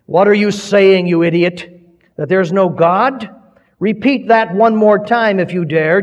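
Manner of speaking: loud, high, thundering challenge. A narrator's reading. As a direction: roar the dare at him. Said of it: "his thundering voice".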